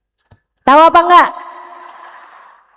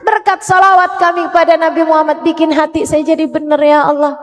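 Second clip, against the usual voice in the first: fervent prayer; 4 semitones higher than usual. Acted. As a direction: beg Allah earnestly, rising. Fervent supplication after sholawat.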